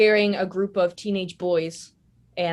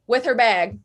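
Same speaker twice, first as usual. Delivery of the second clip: ordinary delivery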